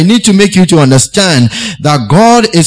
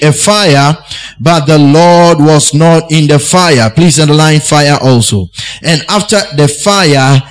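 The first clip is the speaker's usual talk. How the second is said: ordinary talk, nothing marked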